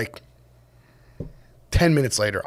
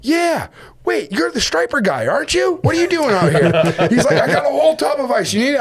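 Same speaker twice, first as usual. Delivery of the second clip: high, cheery and quick; a hearty helpful skipper; friendly captain; 11 semitones higher than usual. Retold or imitated.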